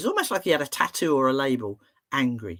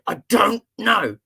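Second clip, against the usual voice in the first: slow, loud, higher mock-angry outburst; very cross child; 5 semitones higher, 6 dB louder, slower than usual. Boy with clenched fists to therapist, imitated fury. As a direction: hammer out each word like a furious small boy. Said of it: "He clenched his fists and went".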